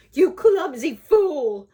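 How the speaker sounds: put-on furious old-lady shriek; screeching. A narrator's reading. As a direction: screech it like a wicked old woman. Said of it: "screeched the old lady".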